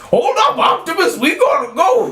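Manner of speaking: high, shouted cartoon robot impression; Jazz. Reminding co-hosts of the character, high urgent shout. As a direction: holler it urgently, pitch way up. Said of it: "Which one was Jazz?".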